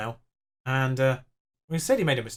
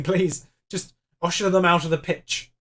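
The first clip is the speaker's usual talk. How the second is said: normal talking voice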